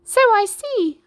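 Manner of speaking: very high, loud squawk; squawking chicken. A narrator's reading. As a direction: squawk it like a hen. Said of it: "squawked the chicken".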